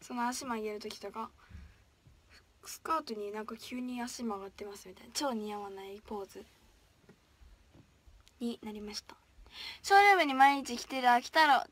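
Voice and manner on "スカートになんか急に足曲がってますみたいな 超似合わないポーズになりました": hushed self-mocking aside; whisper. Real, not acted. Idol laughing at her awkward dance pose, whispering. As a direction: whisper it like a confession.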